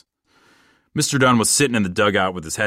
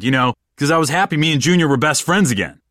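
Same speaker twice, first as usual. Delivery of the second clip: ordinary delivery, nothing marked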